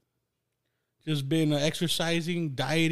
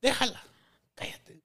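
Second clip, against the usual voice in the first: mock scolding mom; 10 semitones higher than usual. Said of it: "my mom's like".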